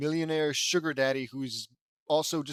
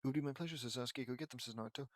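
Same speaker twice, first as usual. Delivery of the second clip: much softer, low murmur; quiet rereading; 3 semitones lower, 11 dB quieter, much faster than usual. Real, not acted. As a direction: read it under the breath.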